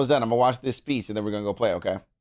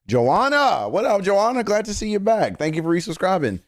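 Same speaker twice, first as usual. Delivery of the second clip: excited greeting; 9 semitones higher than usual. Real, not acted. Greeting subscriber.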